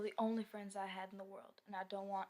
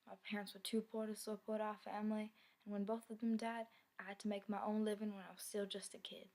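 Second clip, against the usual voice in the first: normal talking voice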